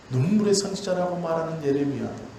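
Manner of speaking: ordinary delivery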